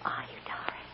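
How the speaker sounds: hushed, whispered questioning; tender whisper. Acted. Mother asking darling if sure, tenderly whispered. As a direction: ask very softly, almost all breath.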